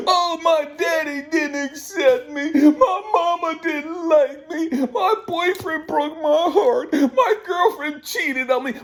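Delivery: high, slow mocking sob voice; mock crybaby. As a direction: whimper it like a whining child to ridicule them. Mocking grown-ups crying over childhood.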